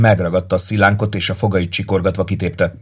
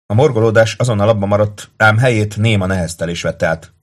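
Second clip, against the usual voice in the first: ordinary delivery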